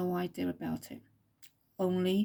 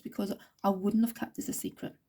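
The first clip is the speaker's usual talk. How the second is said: ordinary talk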